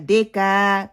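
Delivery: دے کا with e and final a dragged long; slow, wrong "de-kaa"; lengthened ending. Shown as a demonstration. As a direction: stretch کا too long, as in the mistake. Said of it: "کا کو لمبا بھی کر دیتے ہیں".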